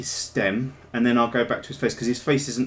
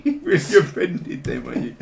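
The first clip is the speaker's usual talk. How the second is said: said through laughter, higher and louder; laughing; 8 semitones higher, 6 dB louder than usual. Real, not acted. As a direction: tease while cracking up. Teasing co-host while laughing.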